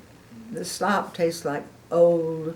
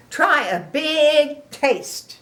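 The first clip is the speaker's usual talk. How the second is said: high, slow, louder coaxing; urging pig; 8 semitones higher, 6 dB louder, much slower than usual. Acted. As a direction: egg him on. Piggy urging.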